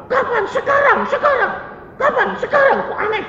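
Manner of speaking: very high, mocking back-and-forth; mimicked argument. Roommate imitating the believer arguing with someone, high and mocking. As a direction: mimic a squabble in a squeaky high voice. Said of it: "bantah-bantahan sama orang".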